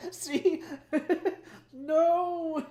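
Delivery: high squealing laughter through the words; real giggle fit, loud and high; cracking up. Real, not acted. Cracks up at own joke.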